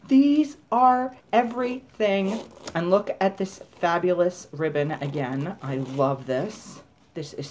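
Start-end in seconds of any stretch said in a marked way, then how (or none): 0.1-2.4 high, slow gushing delight; thrilled. Real, not acted. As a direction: gush over the leggings. Thrilled by leggings.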